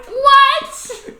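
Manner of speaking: squeaky high, loud mock shock; shocked what. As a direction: play the stunned kid brother, way up high. Brother reacting to game ending.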